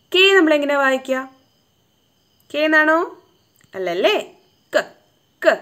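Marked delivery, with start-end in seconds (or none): 4.7-5.5 "ക" as a short "k" sound, not the letter name. Shown as a demonstration. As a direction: say "ക" short, twice with a gap. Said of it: "എന്നാണ് വായിക്കേണ്ടത്".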